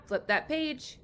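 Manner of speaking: normal talking voice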